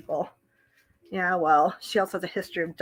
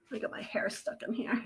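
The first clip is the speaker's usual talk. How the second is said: whisper; 12 dB quieter than usual. Real, not acted. Hair caught, muttering to self.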